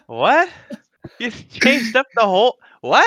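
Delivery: high, stunned "what?"; can't believe what he heard; shocked disbelief. Real, not acted. S2 reacts to S1's surprise verdict.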